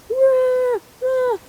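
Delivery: loud, high hammed-up roars; mock dinosaur roars; playful roar. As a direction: roar like a toy monster.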